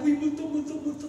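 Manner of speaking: put-on high, whimpering child crying ghost; like a frightened little kid; scared child. Preacher voices children scared of ghosts, whimpering. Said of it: "Parang yung mga bata na".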